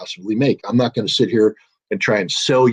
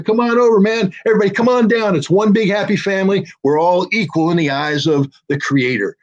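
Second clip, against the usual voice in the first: rising higher and higher as he calls everyone over; a friendly host calling guests in, getting more and more excited; playing Russia inviting the world in, much higher and more excited; cheerful mock welcome; 7 semitones higher than usual. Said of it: "if Russia says".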